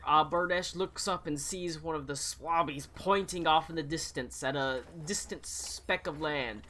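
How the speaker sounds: normal talking voice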